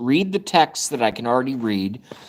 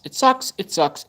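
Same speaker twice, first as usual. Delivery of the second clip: high squeaky whine on "It sucks"; whiny squeak; 6 semitones higher than usual. Real, not acted. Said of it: "mock high whine".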